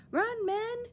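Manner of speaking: high, loud warning shout; foreman's cry. Acted. Foreman warns the men of the blast, loud high shout. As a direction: yell to get everyone clear. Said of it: "cried the foreman".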